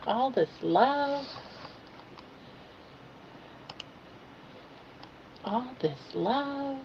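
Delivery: high, slow cooing to a pet. Real, not acted. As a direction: coo lovingly at the dog.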